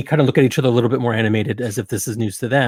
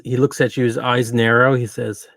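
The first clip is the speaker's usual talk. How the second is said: usual speaking voice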